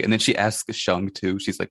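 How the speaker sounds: ordinary talk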